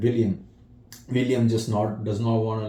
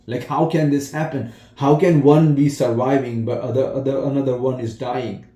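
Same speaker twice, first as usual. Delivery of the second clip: louder, higher, raging disbelief; anguished anger; 4 semitones higher, 6 dB louder than usual. Retold or imitated. Grieving father demanding why his son died, angry. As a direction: demand an answer in rising anger. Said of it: "He's mad".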